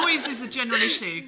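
ordinary talk, nothing marked

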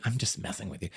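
softly admitting the joke; quiet aside. Retold or imitated. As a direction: drop the voice, a sheepish tease. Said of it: "I was like".

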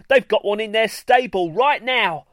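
high, loud eager boast; can't wait to show them; excited. A narrator's reading. Greenstar eagerly telling gathered unicorns, excited.

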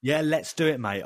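ordinary talk, nothing marked

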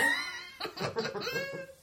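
very high-pitched laugh; unreal on-pod laugh. Real, not acted. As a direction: let out a high, unnatural giggle. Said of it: "That wasn't real".

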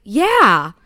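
loud, drawn-out yeah; emphatic retort. Retold or imitated. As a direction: throw it back emphatically. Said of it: "And I'm like".

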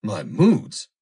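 loud, bitter echoed question; bitter retort. A narrator's reading. Foster stung by the girl's rebuke, bitter. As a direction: throw it back bitterly, rising at the end. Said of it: "Foster repeated bitterly".

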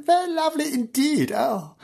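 mock swoon. [name] admiring the castle.

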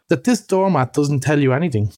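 ordinary delivery, nothing marked

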